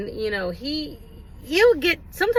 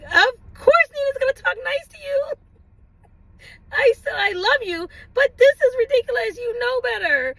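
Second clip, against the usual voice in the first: much higher, slow, loving reproach; tender scolding; 10 semitones higher than usual. Retold or imitated. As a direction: hug him while still scolding. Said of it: "gotta give him a hug and be like".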